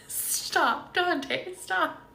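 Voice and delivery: high, loud laughing protest. Real, not acted. Healer cracking up at a viewer's comment, laughing loudly. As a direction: laugh through the pleading. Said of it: "I will just never stop laughing".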